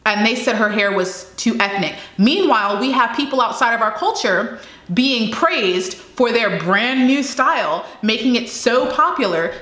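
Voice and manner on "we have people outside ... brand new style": ordinary delivery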